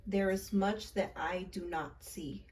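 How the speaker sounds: slow, earnest recital of a spell; incantation recital. Shown as a demonstration. Leading viewers in the incantation, slow and earnest. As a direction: say each line as a solemn spell. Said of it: "one more time".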